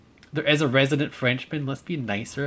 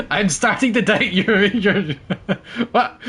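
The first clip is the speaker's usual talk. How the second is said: laughing; 9 semitones higher, 7 dB louder than usual. Real, not acted. Laughing at viewer's eclair claim.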